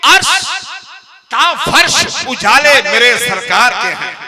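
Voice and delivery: slow, lifted naat line; reciting a verse. Acted. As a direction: intone it slowly for the gathering. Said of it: "शेर पढ़ता हूं कि".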